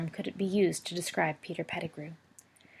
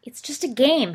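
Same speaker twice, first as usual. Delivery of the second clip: put-on higher, louder defiant boy; boy defends brother against father fiercely; fierce retort; 7 semitones higher, 7 dB louder than usual. A narrator's reading. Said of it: "he said fiercely".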